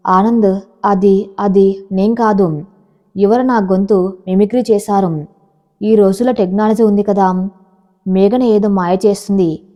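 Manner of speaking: ordinary delivery, nothing marked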